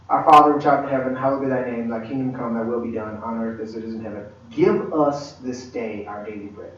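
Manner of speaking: low, softened recital of a prayer; rote prayer recitation. Retold or imitated. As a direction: recite by heart, slowing on the last line.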